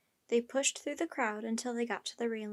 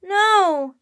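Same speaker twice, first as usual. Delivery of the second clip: high, very loud protest; yelling; 7 semitones higher, 14 dB louder than usual. A narrator's reading. Annie objects to the harpoon throw, yelling. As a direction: yell out in alarm. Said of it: "yelled Annie".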